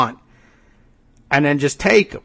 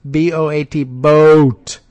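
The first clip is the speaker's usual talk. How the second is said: "B O A T, boat" spelled letter by letter, "boat" hit louder; 4 dB louder, slower than usual. Shown as a demonstration. Host spelling the word boat for emphasis. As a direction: spell "B O A T" then punch "boat". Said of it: "spells out boat".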